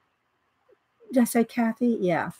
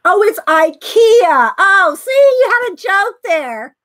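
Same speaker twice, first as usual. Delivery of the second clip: high, loud, laughing as the joke lands; laughing realization; 7 semitones higher, 6 dB louder than usual. Real, not acted. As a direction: burst out laughing at the pun. Gets the joke, bursts out laughing.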